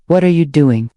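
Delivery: ordinary delivery, nothing marked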